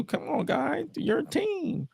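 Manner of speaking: high pleading woman voice, played for laughs; squeaky girly plea; high Lita voice.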